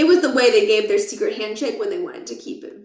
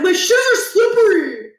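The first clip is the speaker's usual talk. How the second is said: high, loud alarmed yell; startled teen shout; 11 semitones higher, 6 dB louder than usual. Acted. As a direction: yell it from the floor. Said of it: "he shouted".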